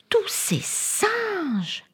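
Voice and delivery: very high, stretched-out wonder; amazed delight. A narrator's reading. Anna seeing all the monkeys at the zoo, awestruck. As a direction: draw the words out high in amazement.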